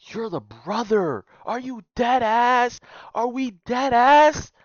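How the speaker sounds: high, slow, loud disbelief; incredulous shout. Real, not acted. Reacting to the brother's betrayal, high incredulous yell. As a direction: stretch out the disbelief.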